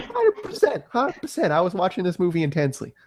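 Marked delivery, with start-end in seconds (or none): 0.0-1.5 high squeaky agreement. Real, not acted. Eager agreement.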